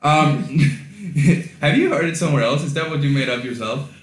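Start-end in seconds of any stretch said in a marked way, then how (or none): 0.5-1.6 a quick real laugh that falls away; short laugh. Real, not acted. Host laughs at his co-host's catchphrase, short laugh. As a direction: laugh it off lightly.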